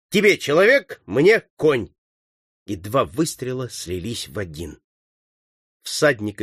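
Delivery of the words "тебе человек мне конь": loud call starting high; shouted command. A narrator's reading. Leader dividing targets with partner, shouting. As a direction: call out who takes which target. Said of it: "крикнул Сорвиголова".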